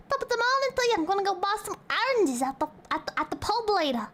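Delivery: put-on silly British accent starting high; hammed-up caricature of a Brit; mock British. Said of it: "They'd sound like that".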